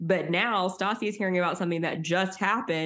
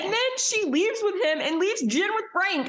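exasperated; 10 semitones higher than usual. Real, not acted. Exasperated recap, high far.

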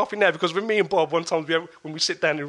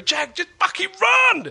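louder and higher, slow desperate shout; urgent yell; 11 semitones higher, 6 dB louder, slower than usual. Retold or imitated. Brother shouting at Jag to flee, desperate yell. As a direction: yell it like a frantic big brother. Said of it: "So that's why".

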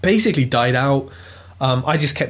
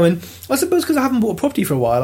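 normal talking voice

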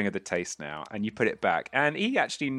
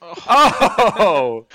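long, loud "oh" of reaction; high, drawn-out crowd-style oh; real groaning cheer; 8 semitones higher, 13 dB louder than usual. Real, not acted. Game master reacts to failed roll.

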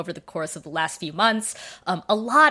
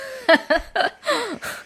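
embarrassed laugh; 14 semitones higher than usual. Real, not acted. Embarrassed after losing train of thought.

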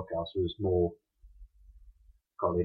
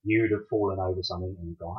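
ordinary talk, nothing marked

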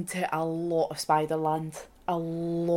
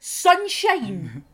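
mocking; 8 semitones higher, 5 dB louder than usual. Mocking the driver.

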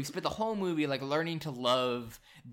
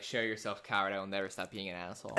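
lower, softer, fast mock boast; lightly voiced defiant pig; mock defiant hero; 5 semitones lower, 6 dB quieter, faster than usual. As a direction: declare it like a hammy villain. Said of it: "he's like".

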